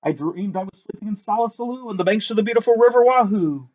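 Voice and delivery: ordinary delivery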